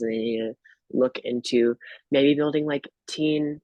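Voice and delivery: ordinary talk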